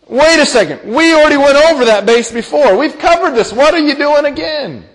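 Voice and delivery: high-pitched, incredulous parental protest; exasperated parent. Acted. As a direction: protest like a parent who's been through this already. Acted parent frustrated at repeated disobedience.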